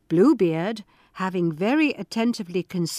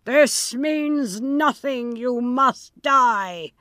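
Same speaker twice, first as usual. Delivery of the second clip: slow, grim, higher; cold Bluebeard; 4 semitones higher, 3 dB louder, much slower than usual. Acted. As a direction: deliver the verdict coldly. Bluebeard's final sentence.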